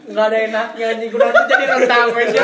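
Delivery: cracking up mid-sentence; laughing. Real, not acted. Laughing after the rice cooker joke.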